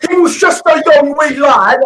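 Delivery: put-on high British accent, far from his own voice; Will Ospreay impression. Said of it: "did that impression of".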